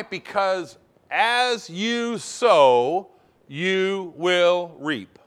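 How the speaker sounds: drawn out, each word weighed; slow it right down to drive it home; preacher stresses the sowing principle slowly; very slow emphasis. Real, not acted. Slow emphatic teaching line.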